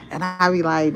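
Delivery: normal talking voice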